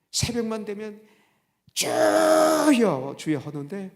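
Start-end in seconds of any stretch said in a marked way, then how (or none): 1.7-3.4 very slow, drawn-out cry to the Lord, much higher and louder. Retold or imitated. Death-row inmate praying at dawn, howling loudly. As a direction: bellow 주여 with the whole chest, stretched long. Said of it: "돼지 목 닫는 소리죠".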